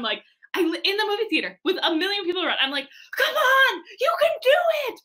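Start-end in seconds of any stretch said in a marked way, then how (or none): 3.2-5.0 high, loud urging yell; yelling at a screen. Retold or imitated. Moviegoer yelling encouragement at the film heroine, high urging shout. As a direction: cheer them on at full pitch. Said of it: "I yell at the screen".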